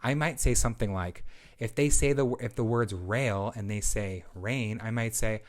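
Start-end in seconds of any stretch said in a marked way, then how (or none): none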